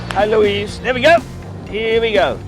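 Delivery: normal talking voice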